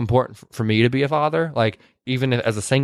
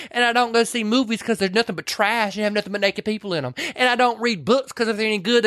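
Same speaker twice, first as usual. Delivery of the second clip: higher-pitched, insistent grumbling; mock curmudgeonly rant; 6 semitones higher than usual. Retelling Weezer setting Clary straight, high-pitched cranky rant. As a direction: list grievances in a raised voice.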